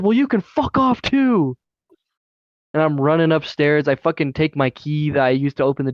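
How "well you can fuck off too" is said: high, slow mock yell; re-enacted defiance, pitched way up; retold angry comeback. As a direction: throw it back high and drawn out. Acting out his angry comeback.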